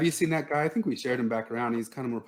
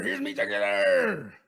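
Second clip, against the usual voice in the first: mock Irish accent; 4 semitones lower, slower than usual. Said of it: "talk in bad Irish accents".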